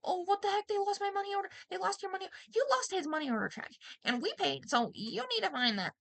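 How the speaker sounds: high shrill-toned exasperated protest; frantic arguing. Retold or imitated. She argues with leasing office.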